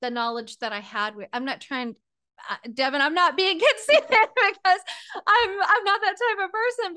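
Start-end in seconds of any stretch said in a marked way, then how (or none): 3.0-6.9 higher, louder, laughing through the words. Real, not acted. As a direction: laugh while brushing off the idea of being conceited. Laughs while denying being conceited.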